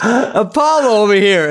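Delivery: imitated stand-up pitched high; high, projected stage voice; comedian onstage. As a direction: play a comic insisting on his local reference. Retold comic pressing his bit onstage.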